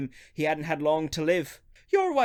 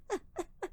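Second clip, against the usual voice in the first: hysterical laugh-sob; 12 semitones higher, 13 dB quieter than usual. Acted. Joe breaks down at the news.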